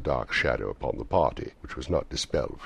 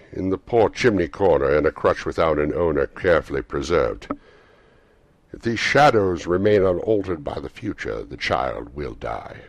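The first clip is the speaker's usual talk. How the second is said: loud, solemn ghostly pronouncement; prophesying ghost; 8 dB louder than usual. A narrator's reading. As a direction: foretell a death with force. Ghost foretells the child's death.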